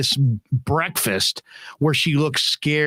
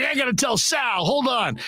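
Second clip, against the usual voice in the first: high, excited acted voice; imitated eager colleague; 9 semitones higher than usual. Colleague off to fetch another, high excited impression. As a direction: play him bursting to share it. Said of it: "He's like".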